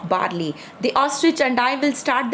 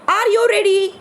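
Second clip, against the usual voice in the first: high-pitched starting question; eager challenge; 9 semitones higher, 4 dB louder than usual. A narrator's reading. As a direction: call it to the rival, pitched high. Ostrich challenges hedgehog at start.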